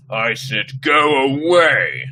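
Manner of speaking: slow, loud monstrous snarl; deep snarl. Acted. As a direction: growl it like a beast. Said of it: "Carly Beth snarled in a voice so deep".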